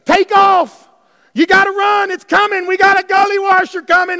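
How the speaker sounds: loud, high shouted panic; hammed-up panicked prophet; frantic Elijah. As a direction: yell like you are fleeing the storm. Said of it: "he goes berserk".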